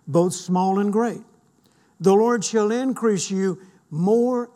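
slow, fervent scripture reading; fervent new convert. Acted. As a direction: proclaim each promise with conviction. Reads scripture with rising fervor.

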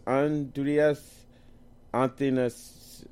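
slow, halting attempt at a hard name; spelling-bee sounding-out. Real, not acted. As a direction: sound it out slowly, unsure of the name. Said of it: "feel like I'm in a spelling bee".

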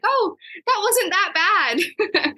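high, slow mock-casual drawl; breezy no-big-deal. New mom joking about her birth photo, high mock-breezy voice. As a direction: brush it off in a high, slow, airy voice.

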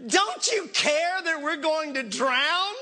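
high, slow, pleading cry; hammed-up desperate call, rising at the end; imitated disciples' shouting. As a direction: stretch out a mock-panicked accusation. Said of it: "the disciples woke him shouting".

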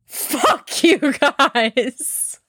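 very slow, high, drawn-out mock outrage; mock indignation. Joker to an audience that won't laugh, mock indignation. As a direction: drag out each word in hammed-up offence. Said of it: "And you're like".

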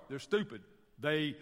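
ordinary talk

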